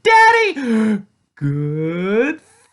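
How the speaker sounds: put-on high child voice, words drawn out; squeal, then stretch it out longingly; eager little girl. A narrator's reading. Tia shows her father the chocolate, eager squeal.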